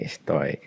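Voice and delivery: "Estoy" with the S barely breathed; "Estoy" with the S gone; dropped S. Shown as a demonstration. Teacher quietly models "Estoy" with the S dropped out. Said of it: "sometimes you'll hear bits of the S".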